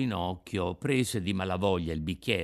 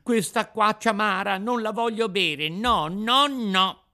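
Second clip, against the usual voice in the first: high, loud puppet tantrum; tearful shouting; 11 semitones higher, 7 dB louder than usual. A narrator's reading. Puppet refusing bitter water, tantrum shout. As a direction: cry out stubbornly in a high child's voice. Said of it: "gridò Pinocchio dando in uno scoppio di pianto".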